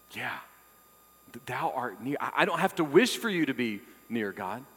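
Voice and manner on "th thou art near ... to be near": usual speaking voice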